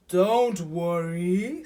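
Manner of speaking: loud, very slow hippo voice; reassuring Hippo. A narrator's reading. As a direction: stretch out a big reassurance.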